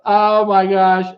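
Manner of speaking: high laugh spilling into a slow "oh my gosh"; laughing at the viewer's joke. Real, not acted. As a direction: crack up, then catch your breath. Laughing at funny comment.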